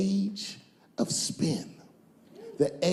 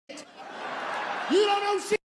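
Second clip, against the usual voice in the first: much higher, slow, stretched yell; drawn-out high shout; 13 semitones higher, much slower than usual. Shown as a demonstration. Comic scolds the young man, slow and shouting high. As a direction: belt it out long and high. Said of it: "repeated take".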